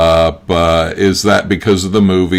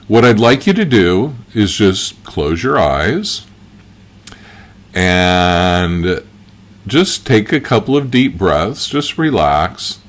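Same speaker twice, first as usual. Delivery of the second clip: guided visualization. Acted. Guide leading listeners into past-life imagining, calm guided visualization. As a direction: ease them gently inward, leaving space between phrases.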